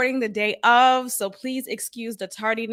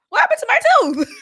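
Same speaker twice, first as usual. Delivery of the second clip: high, fast, laughing shriek; cracking up in disbelief; 7 dB louder, much faster than usual. Real, not acted. Laughing retelling of lost tooth.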